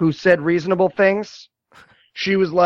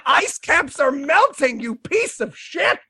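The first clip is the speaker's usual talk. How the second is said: high, slow, strained outrage; exasperated rant; 8 semitones higher, slower than usual. Retold or imitated. Viewer scolding over melting ice caps, exasperated high rant. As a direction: draw it out in high comic fury. Continuing rant at trivial questions.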